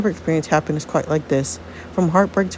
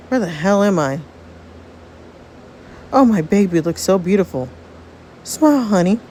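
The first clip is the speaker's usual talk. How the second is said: ordinary talk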